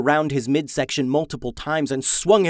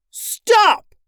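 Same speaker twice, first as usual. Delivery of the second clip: high, loud shouted command; yell; 6 dB louder than usual. A narrator's reading. Owen yelling at the attacking monster, high loud shout. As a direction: yell it out to halt a charging beast. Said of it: "and yelled".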